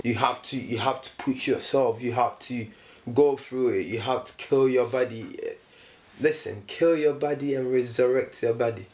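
ordinary delivery

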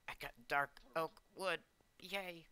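high, lifted little cheer; excited. Real, not acted. Player finding dark oak wood, excited. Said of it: "I don't know why I'm excited for that".

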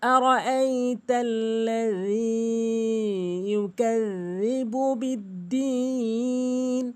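slow, melodic Quran recitation; correct recitation. Shown as a demonstration. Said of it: "শুদ্ধভাবে কীভাবে হবে". (শুদ্ধ, ধীর তিলাওয়াত)

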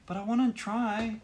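high, slow whiny begging; whiny plea. Real, not acted. Begging partner to play.

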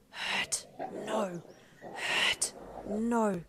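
rough, forceful broken words; growling creature. A narrator's reading. Creature answers whether it will hurt doctor, growling. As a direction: burst out each word like an animal.

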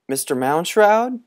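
high calling into the tomb. Acted. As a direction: call out into the dark. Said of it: "called Tom Skelton".

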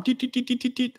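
high, quick tit tit chirps; imitated phone beeps. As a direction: chirp like notification pings.

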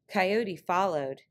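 ordinary delivery

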